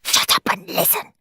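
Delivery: hissed, urgent whisper; fierce whisper. A narrator's reading. Henry whispering fiercely to his sleepy brother. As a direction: whisper it fiercely so no one wakes. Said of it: "whispered Henry fiercely".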